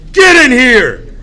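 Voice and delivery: loud, slow, stern command; a mother snapping her child inside. Retold or imitated. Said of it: "She says".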